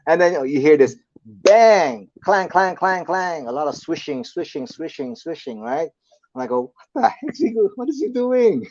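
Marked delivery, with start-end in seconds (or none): 1.3-3.5 loud, high banging noises; imitated clanging. Storyteller imitating noises from the bathroom, loud clanging. 6.9-8.7 very high, bewildered exclamation; mock alarm. Host hearing strange noises at night, mock alarm.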